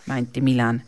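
ordinary delivery

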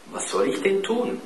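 put-on high, uncertain boy's question; nervous question. A narrator's reading. Klaus fragt unsicher. (unsichere, hohe Frage)

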